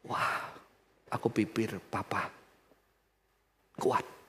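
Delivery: put-on hushed child's reply. Son's quiet response to father.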